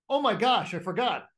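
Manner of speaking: the mind suddenly remembering its limit; mock sudden alarm. The mind to the lifter at rep nineteen, mock panic. Inner voice at nineteen push-ups.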